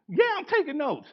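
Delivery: high, bright student voice shooting back; mock student reply. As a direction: answer like a proud note-taker. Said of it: "you looked up at me like".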